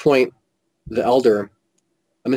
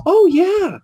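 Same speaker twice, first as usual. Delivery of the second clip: high, drawn-out imitation of a lady; lady at the table; 5 dB louder than usual. Lady answering the stranger's question, high-pitched imitation. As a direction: mimic her with a jump up in pitch. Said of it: "the lady closest to me goes".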